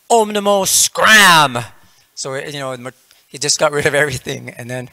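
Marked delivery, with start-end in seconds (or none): 0.1-1.6 high, loud, slow chant exploding into a shout; banishing incantation. Retold or imitated. Yogi getting rid of everything, exasperated shouted incantation. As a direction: intone the spell, then bellow the last word.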